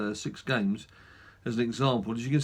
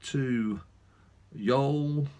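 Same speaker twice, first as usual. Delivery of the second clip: accidental, light southern US drawl. Real, not acted. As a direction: slip into a touch of American South. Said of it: "suddenly developed a southern drawl there".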